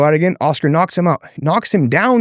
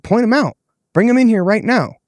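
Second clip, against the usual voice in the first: angry challenge; 8 semitones higher than usual. Retold or imitated. Confronting promoter at weigh-ins.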